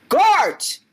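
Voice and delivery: high, sudden shout for help; king yelling out for his men; yelled summons. Acted. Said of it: "And he yells out".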